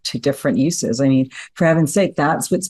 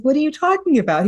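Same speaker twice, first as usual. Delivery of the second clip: much higher, fast baffled reply; mock bafflement; 13 semitones higher, faster than usual. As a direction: sound completely thrown. Baffled father replies.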